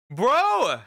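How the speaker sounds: high, loud "Bro!" dissolving into laughter; laughing yell of disbelief; shouting laugh. Real, not acted. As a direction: shout it, then crack up.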